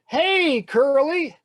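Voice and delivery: high, loud repeated call; yelling. Acted. Benny walking towards the boy, yelling. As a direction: holler it at someone across the yard. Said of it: "he keeps yelling".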